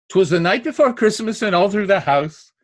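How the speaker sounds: bouncing verse recital stressing "night", "Christmas", "all", "house"; anapest rhythm. Shown as a demonstration. Example from a poem for children, anapest rhythm. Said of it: "One of them is the Anapest".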